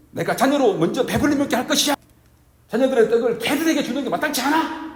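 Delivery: high, loud rebuking shout; overdone loud scolding to show the wrong way; mock shout. Shown as a demonstration. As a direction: bellow it at her like a harsh rebuke. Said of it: "소리를 지르면서 그렇게 하진 않았을 거예요".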